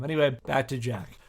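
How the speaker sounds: usual speaking voice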